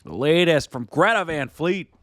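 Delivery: high, upbeat radio promo read. Pretend. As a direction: tease the next segment on air.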